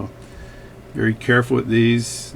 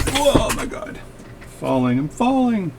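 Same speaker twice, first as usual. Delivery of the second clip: startled yelp; 10 semitones higher than usual. Real, not acted. Seat drops suddenly.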